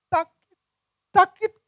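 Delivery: very high, mock-wounded little voice; acted hurt wife. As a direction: play up the hurt feelings. (suara tinggi pura-pura sakit hati)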